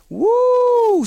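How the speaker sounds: loud, high excited whoop. Real, not acted. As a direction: let out a big, high woo. Reacting after mock attack.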